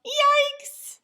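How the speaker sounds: high, loud shocked yelp; startled yell. A narrator's reading. Father spotting the overloaded cart, high startled yelp. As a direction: jump at what you see.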